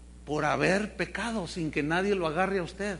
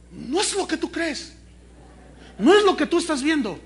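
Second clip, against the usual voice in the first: higher, louder telenovela scolding; hammed-up angry wife; 6 semitones higher, 6 dB louder than usual. Wife confronts cheating husband outside hotel, mock fury. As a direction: play the betrayed wife over the top.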